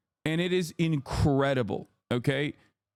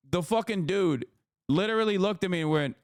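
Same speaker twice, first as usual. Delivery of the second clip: usual speaking voice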